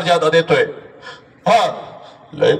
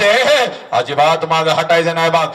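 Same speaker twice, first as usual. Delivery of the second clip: usual speaking voice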